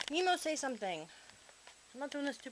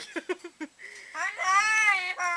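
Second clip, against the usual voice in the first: big high cackling laugh, loud; loud burst of laughter; 10 semitones higher, 9 dB louder than usual. Real, not acted. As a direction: crack up at your own joke. Laughs at own joke.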